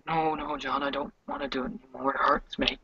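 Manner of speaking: soft, fast, broken pleading; tearful pleading. Acted. Sasha begging absent John, softly and tearfully. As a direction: beg him to stop through tears. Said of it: "crying even harder then".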